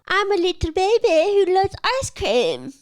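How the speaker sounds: high, loud, stilted mock voice; mocking the ice cream guy. Mocking the ad guy to viewers, stilted high voice. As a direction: parrot him like he barely speaks English. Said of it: "talking like he just learned English".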